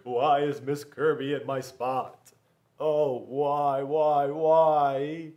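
put-on loud, wailing kid's why; distressed boy. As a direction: plead in childish despair. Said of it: "Bobby was like".

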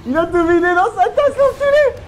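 high laughing outrage; laughing shout. Real, not acted. Caught friend emptying his cup.

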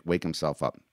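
plain reading voice

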